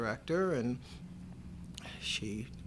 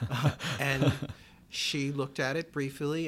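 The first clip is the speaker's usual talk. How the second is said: ordinary talk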